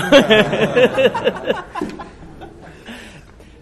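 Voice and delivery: big high burst of real laughter; loud laugh. Real, not acted. Laughs at the punchline.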